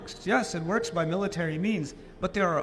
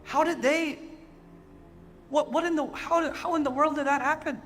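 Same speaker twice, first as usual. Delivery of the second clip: high, disbelieving "how in the world"; amazed wondering; 6 semitones higher than usual. Retold or imitated. Preacher retelling his amazement at English farmland. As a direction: marvel aloud, stunned. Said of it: "I look at it and I say".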